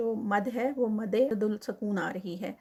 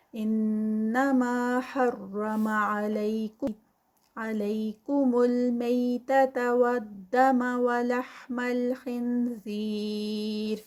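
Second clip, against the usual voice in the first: slow, louder melodic chant; Quran recitation; 4 dB louder, much slower than usual. Shown as a demonstration. Teacher reciting the verse aloud, slow and melodic. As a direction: draw out each word like in recitation. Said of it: "recites the verse".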